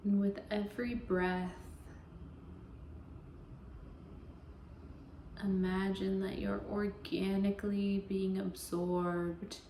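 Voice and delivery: slow, even meditation voice with long pauses; guided meditation. Acted. Yoga teacher easing listeners into Yoga Nidra, guided meditation voice. Leads a guided relaxation practice.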